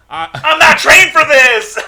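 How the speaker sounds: high, loud, drawn-out cry; panicked outburst. Acted. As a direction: wail it like an overwhelmed officer. Overwhelmed officer cries out.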